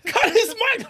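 high mock shout. Real, not acted. Laughing mock command.

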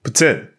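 "Poutine" said with an S after the T, like Putin; French Canadian "put-SIN" for "Poutine"; proper pronunciation. Shown as a demonstration. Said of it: "Give us the proper pronunciation".

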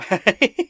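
high, loud burst of giggling; real high-pitched laugh; high giggle. Real, not acted. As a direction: giggle helplessly at bad luck. Player laughs after oh no.

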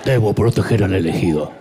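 low, loud robotic monotone; deadpan movie robot; mock Terminator drone. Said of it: "como Terminator".

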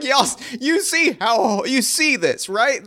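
squeaky high, slow disbelief; incredulous mock voice. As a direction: pitch way up like you can't believe this. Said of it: "They're like".

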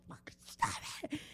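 a mock curse under the breath; muttered curse. Retelling road rage to a friend, a hushed mock curse. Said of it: "I was like".

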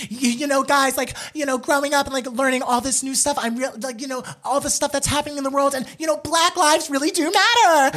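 high-pitched imitation of an impassioned girl; mock screaming. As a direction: plead it in a high voice, mock screaming without much volume. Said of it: "sitting there in the living room screaming".

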